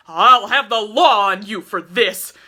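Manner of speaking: loud, breathless threat; loud shaken threat; gasped threat. A narrator's reading. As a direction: gasp it out, shaken but defiant. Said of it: "he gasped".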